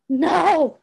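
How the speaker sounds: loud, high desperate scream of "No!". A narrator's reading. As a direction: scream it at the top of your lungs. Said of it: "Stiles screamed".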